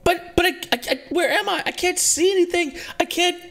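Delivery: put-on high, panicked little girl's voice; terrified girl's voice echoing in Sashira's mind; scared young girl. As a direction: plead like a lost, frightened child.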